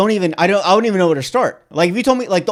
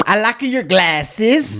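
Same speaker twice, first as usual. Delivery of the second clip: slow, high mock-Kazakh accent; Borat; 6 semitones higher, much slower than usual. Fan quoting a favourite film, Borat impression. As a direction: draw out each word with a foreign lilt. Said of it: "I love Borat".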